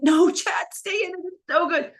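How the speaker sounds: high, excited urging from the sidelines; will him on, high and pleading; retold call to a speaker who forgot his speech, high and urgent; urgent encouragement. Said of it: "And I was like".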